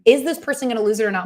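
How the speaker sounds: usual speaking voice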